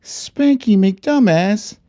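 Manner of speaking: put-on high, slow read-aloud children's book voice; storybook reading. Streamer reading a parody children's book to viewers in a high, slow storybook voice. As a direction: read it like a bedtime picture book, sing-song.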